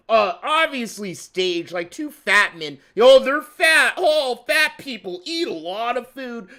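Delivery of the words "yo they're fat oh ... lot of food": high, loud hammed-up discovery; mock amazement. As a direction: exclaim it like a gullible onlooker. Mocking reaction to staged picture.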